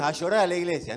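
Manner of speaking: loud, mocking brush-off; dismissive retort. Preacher mocking a friend who brushes off someone crying, loudly. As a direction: toss it off like a scornful friend. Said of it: "y ahí no le digas".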